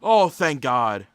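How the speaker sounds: loud, slow, low exhale of relief; heavy, drawn-out relief; great relief. Real, not acted. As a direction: let it out with heartfelt relief. Relief after the next pick.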